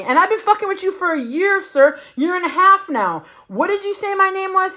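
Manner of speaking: retold outrage; indignant confrontation. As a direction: call him out in front of everyone. Confronting him before family.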